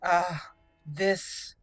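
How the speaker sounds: ordinary talk, nothing marked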